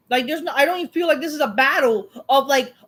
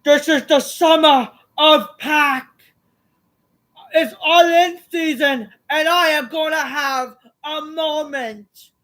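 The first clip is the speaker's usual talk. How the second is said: raised, slowed mock-heroic wrestler promo voice; imitating Pac; 4 semitones higher, 5 dB louder than usual. Reviewer mocking Pac's promo, in a slow, raised mock-heroic voice. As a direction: ham up a grand wrestler's boast, higher and slower. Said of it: "Pac then says".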